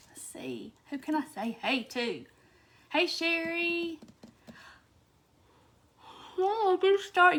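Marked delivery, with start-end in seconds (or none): 0.1-2.3 breathy whisper to herself. Real, not acted. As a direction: whisper it under your breath. Quietly scanning the chat.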